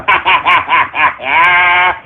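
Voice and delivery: long deep villain cackle; evil cackle. Acted. Streamer mocking trolls with an evil cackle. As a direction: cackle like a mad overlord.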